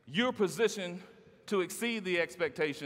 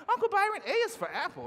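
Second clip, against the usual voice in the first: put-on child's voice; 13 semitones higher than usual. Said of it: "my youngest nephew would say".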